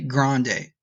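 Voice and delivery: grande in an American accent, GRAND-ay with an English r. Shown as a demonstration. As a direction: anglicize grande like an American tourist. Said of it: "Let me put a little, uh, American accent on it".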